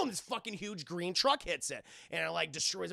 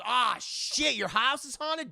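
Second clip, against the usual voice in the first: higher, louder, hammed-up surprise; mock surprised shopkeeper; 7 semitones higher, 7 dB louder, slower than usual. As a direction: play the sassy bookseller. Shopkeeper voiced.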